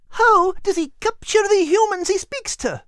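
very high, small Scottish dog voice; a tiny Scottish terrier speaking gently; whimpering little dog. A narrator's reading. Kate asks.

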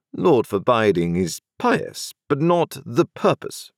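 a crisp, authoritative London lawyer; stern lawyer voice. A narrator's reading. Jaggers retorts.

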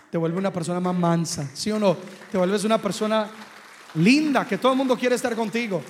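loud, ringing preacher's cry; full-force preaching. Acted. Preacher praises the humble, then moves on. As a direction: lift the room with it.

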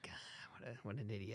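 hushed, scornful whisper; whispering the insult with scorn. Retold or imitated. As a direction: mutter it under your breath, eye-rolling. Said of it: "She's rolling her eyes".

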